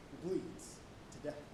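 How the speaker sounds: usual speaking voice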